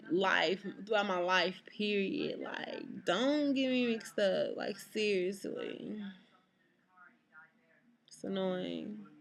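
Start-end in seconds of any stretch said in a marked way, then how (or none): none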